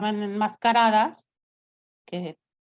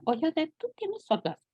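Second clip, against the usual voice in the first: put-on young friend asking; 8 semitones higher than usual. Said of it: "me decía".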